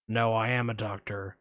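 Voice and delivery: a hint of Buffalo Bill, low; creepy caller. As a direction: lurk low like a shady caller. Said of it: "I'm gonna play both parts".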